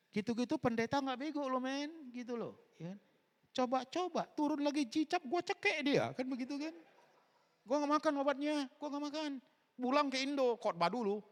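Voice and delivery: triumphant shout to the congregation; defiant boasting. Real, not acted. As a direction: preach it loud, daring the doctors. Defiant boast, preaching at full force.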